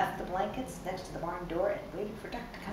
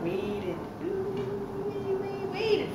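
drawn-out waiting; much slower than usual. A narrator's reading. Drawing out the long wait.

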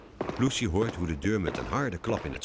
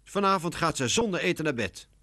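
high, cross pronouncement; indignant, pitched-up scolding; angry mother; 10 semitones higher than usual. A narrator's reading. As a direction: lay down the punishment. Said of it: "zegt Laura boos".

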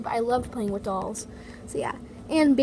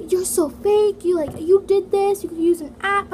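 put-on louder and higher, as the accusing boy; accusing boy quoted; 7 semitones higher, 7 dB louder than usual. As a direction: throw the accusation back, loud and high. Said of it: "he's like".

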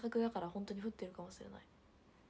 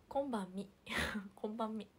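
ordinary talk

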